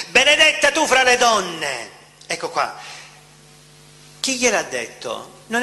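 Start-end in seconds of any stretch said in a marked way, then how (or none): 0.1-1.9 loud exclamation. Acted. Preacher acting Elisabeth greeting Mary, exclaiming loudly. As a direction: cry it out loud and high, full voice. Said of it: "esclamò a gran voce".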